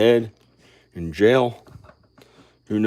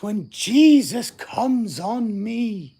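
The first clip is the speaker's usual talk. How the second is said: high, very slow, drawn-out preacher intoning; mock the preacher's solemn delivery, stretching each word; hammed-up pulpit voice, high and slow; imitating a preacher; 8 semitones higher, 6 dB louder, much slower than usual. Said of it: "a preacher ... who talks like this".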